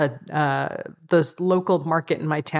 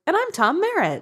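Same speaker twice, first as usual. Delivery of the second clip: much higher, perky intro line; 13 semitones higher than usual. Shown as a demonstration. Co-host showing Tom a higher, perkier intro. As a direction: lift the whole line up high and bright-voiced. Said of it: "you can say it more like".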